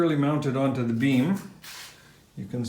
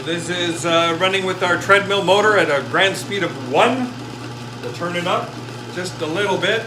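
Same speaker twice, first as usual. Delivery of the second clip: excited; 5 semitones higher, 4 dB louder than usual. Real, not acted. Showing off the running motor.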